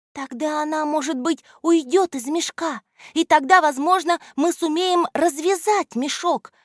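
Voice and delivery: put-on high anxious girlish voice; worried girl. A narrator's reading. Alya worries how to dissolve the salt: high anxious voice. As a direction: fret like a little girl. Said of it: "заволновалась Аля".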